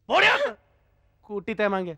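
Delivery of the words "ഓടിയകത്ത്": shouted order. Acted. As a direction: yell the order at the crying girl. Repeated order, shouted high. (ഉയർന്ന ശബ്ദത്തിൽ ആജ്ഞ)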